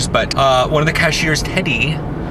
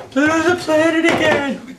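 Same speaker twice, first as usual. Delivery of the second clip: much higher, gushing mock fangirl voice; mocking Tumblr fan. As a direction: parody the excited fans, squealing high. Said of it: "the big thing that I see on Tumblr".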